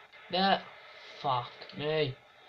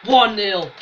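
excited shout; 3 semitones higher, 8 dB louder than usual. Real, not acted. Celebrating the goal.

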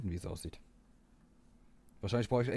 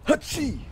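loud sudden sneeze; real sneeze, high and sharp; sneezing; 5 dB louder than usual. Real, not acted.

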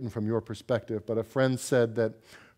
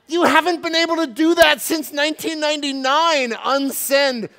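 loud, much higher mocking wife; mocking retort; 10 dB louder than usual. Wife mocking husband who wants to unsend the email, loud and high. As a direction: scoff at him loudly.

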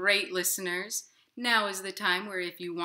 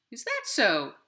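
a lightly voiced robin, a touch higher; 5 semitones higher, 8 dB louder than usual. Robin questioning the inchworm, lightly voiced and skeptical. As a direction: skeptical bird. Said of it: "said the robin".

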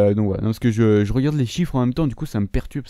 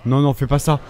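loud, exasperated outburst; furious rant; 7 dB louder than usual. Real, not acted. Gamer ranting loudly at an opponent's tactic. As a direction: vent your rage at the cheap play.

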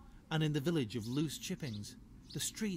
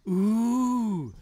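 much higher, much louder drawn-out ooh; impressed badgers; 9 semitones higher, 15 dB louder than usual. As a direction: gasp in wonder as a crowd of badgers. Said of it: "said all the other badgers".

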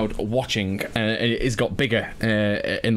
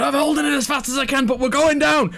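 high, fast panicked yell; bracing for a crash; 5 dB louder than usual. Acted. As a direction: shout the warning before impact. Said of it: "Orion going".

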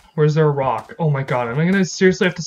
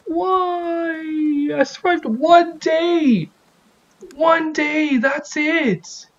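anguished; 9 semitones higher, much slower than usual. Real, not acted. Died after one day.

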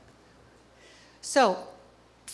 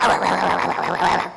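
greedy gobbling grunts; 3 semitones lower, 6 dB louder than usual. Pretend. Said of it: "So you're just like".

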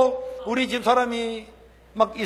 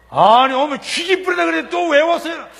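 annoyed retort; 5 semitones higher, 8 dB louder than usual. Retold or imitated. Annoyed villager confronts pastor.

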